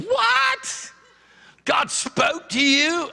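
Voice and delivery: very high shocked yelp, then slowing; disbelieving shout. Retold or imitated. Friend hearing God spoke, high disbelieving yelp. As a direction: cry out in disbelief.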